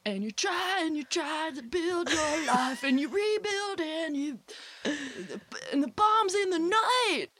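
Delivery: high, belted rock anthem parody; sing-speak it like a heartland rock ballad. Pretend. Mock [name] anthem lyric.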